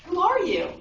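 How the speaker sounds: high, loud questioning call; confused boy calling out; retold yell. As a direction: yell it across the valley. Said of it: "so he yells out".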